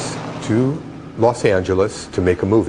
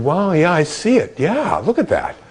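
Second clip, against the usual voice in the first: excited; 8 semitones higher than usual. Retold or imitated. Agreeing about the cloud face.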